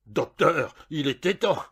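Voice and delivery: loud relief at the doctor's arrival; urgent exclamation. A narrator's reading. Patient urgently greets doctor.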